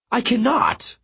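very high despairing wail. A narrator's reading. Apollo refuses the demigods in a high wail. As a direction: cry out that it's hopeless. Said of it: "Apollo wailed".

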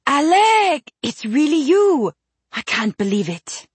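put-on excited French accent, lilting and high; delighted, with a French accent. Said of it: "but with a distinct French accent".

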